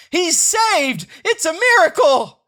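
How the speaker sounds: high, loud announcer cheer; hammed-up announcer excitement; excited. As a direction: proclaim the miracle.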